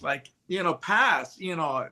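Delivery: ordinary talk, nothing marked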